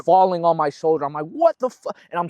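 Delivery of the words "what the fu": retold pained shout, pitched way up; high startled cry cut off mid-curse; panicked outcry. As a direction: relive the shock of the pain. Waking in excruciating arm pain.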